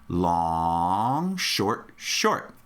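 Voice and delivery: LONG stretched low, each SHORT brief; rhythm demo. Shown as a demonstration. Teacher modelling the rhythm for students, long held out, shorts brief. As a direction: hold "long", then two quick "short"s. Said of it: "Can you say that with me?".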